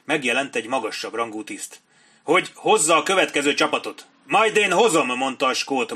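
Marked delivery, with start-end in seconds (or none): 2.2-4.0 higher, louder answer; raised officer's voice; shouted reply. A narrator's reading. 4.2-5.1 high, loud retort; raised, brisk officer's voice; called-out reply. A narrator's reading. Captain calls back amid battle.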